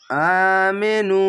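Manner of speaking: আমেনু recited slowly with vowels held one count; chanted Arabic word; one alif stretch. Shown as a demonstration. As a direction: draw out আমেনু in recitation style. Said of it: "এক আলিফ টেনে পড়বেন".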